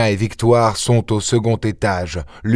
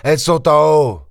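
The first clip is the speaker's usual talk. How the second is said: raised, urgent exclamation; sudden cry; 7 semitones higher, faster than usual. Acted. Ganimard cries out to his men, raised and urgent. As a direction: call it out as you realise. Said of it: "s'écria Ganimard".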